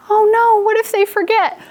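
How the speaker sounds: high-pitched, mock-panicked fretting; worried ghost. Teacher playing the worried ghost digraph, high fretting. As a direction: fret in a high little voice. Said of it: "That's why he's worried".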